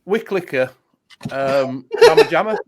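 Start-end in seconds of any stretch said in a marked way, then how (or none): 2.0-2.6 high, loud shout of the name; loud call. Acted. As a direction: belt it out playfully. Vape flavour called out loud.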